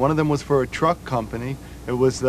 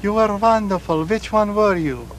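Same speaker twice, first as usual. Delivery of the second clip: put-on a light Hungarian accent, slightly higher, slowing on the last line; 6 semitones higher than usual. [name] imitates the mother praising the wrong son, in a light Hungarian accent. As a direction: play the confused Hungarian mother. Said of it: "that's my Hungarian accent".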